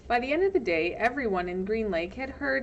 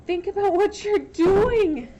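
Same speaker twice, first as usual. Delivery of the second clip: high, loud pleading cry; urgent high plea; desperate cry; 11 semitones higher, 7 dB louder than usual. Acted. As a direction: beg the mob to stop. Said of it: "cried Miss Catherine".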